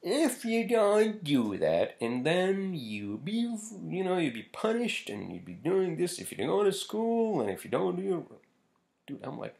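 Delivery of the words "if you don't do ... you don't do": Doctor Phil. Retold or imitated. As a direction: stretch the threat out in a badly faked Southern accent. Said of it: "and he goes like".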